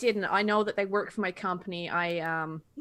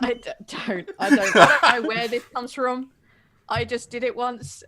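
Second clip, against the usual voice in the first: laughing; 5 semitones higher, 11 dB louder than usual. Real, not acted. Laughing about her own odd accent.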